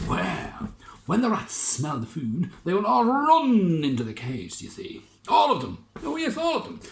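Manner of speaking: high, loud, gleeful inventor; scheming inventor. Acted. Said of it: "inventor explaining his plan".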